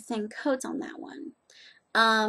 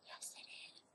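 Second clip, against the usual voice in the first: whispered retort; 26 dB quieter than usual. Retold or imitated. Retold argument with professor.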